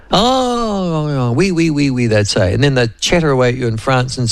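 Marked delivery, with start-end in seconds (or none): 0.1-2.1 long high "oh" sliding into quick ouis; playful French cooing; mock French delight. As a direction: imitate delighted French locals. Voices French people's delighted reply.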